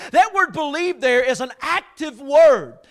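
loud, driving sermon delivery; preaching at full force. Acted. Pastor preaching to his congregation, loud and driving. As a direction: preach it hard to the room.

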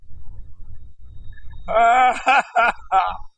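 wordless cry rising high. Real, not acted. Preacher overcome at the altar call, crying out.

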